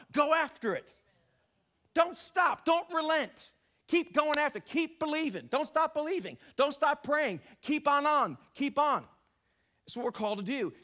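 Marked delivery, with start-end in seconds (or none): 0.1-9.1 loud, high, driving exhortation; fiery preaching. Acted. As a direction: push the congregation, don't let up. Preaching exhortation at full force.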